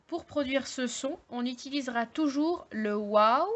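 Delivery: ordinary talk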